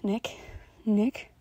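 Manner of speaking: whining a name. A narrator's reading. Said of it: "whining my name".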